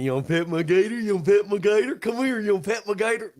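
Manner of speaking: high-pitched, repetitive coaxing; Jeff Mathias. Retold or imitated. Host imitates a man urging people to pet his gator, coaxing. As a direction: put on an insistent gator owner's voice. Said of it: "different than Jeff Mathias going".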